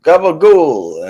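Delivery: drawn-out, loud mock Italian-American; hammed-up Italian-American food slang. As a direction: imitate Italian-Americans, stretching the word out. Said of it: "and they'd say".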